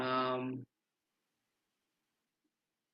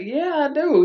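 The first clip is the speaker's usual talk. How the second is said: eager yes; 12 semitones higher, 4 dB louder than usual. Retold or imitated. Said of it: "I was like".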